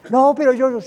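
high, loud, flustered objection; agitated protest. Acted. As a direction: cut in, refusing to calm down. El creyente protesta al ser calmado. (protesta agitada, aguda y fuerte)

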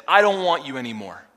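rejecting. Retold or imitated. Five husbands rejecting the woman.

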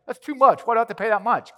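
playing the griping driver, voice pushed up; mock indignant complaint. Preacher voicing a ticketed driver, mock outrage.

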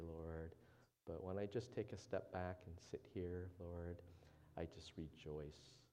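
soft, low, earnest prayer voice. Acted. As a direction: pray quietly and humbly before the congregation. Opening prayer before the sermon.

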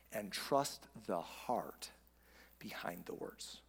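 slow, low, hushed preaching; solemn sermon close. Real, not acted. Pastor urging congregation to give grace, quietly solemn. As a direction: bring it down to a soft, weighty appeal with long pauses.

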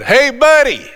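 high, breezy and offhand; jokey casual hello; mock casual greeting. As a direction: toss it off like greeting a pal. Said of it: "he's not going".

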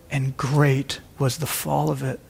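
grave quotation. Acted. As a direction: let the line land heavily, like a warning. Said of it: "We'll quote".

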